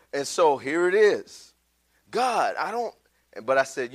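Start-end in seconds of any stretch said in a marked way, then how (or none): 2.1-2.9 making excuses. Acted. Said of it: "The excuses".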